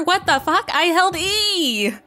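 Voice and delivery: high, loud alarmed cry; startled outburst. Real, not acted. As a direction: yelp it out, caught off guard. Stuck in the game, panicking.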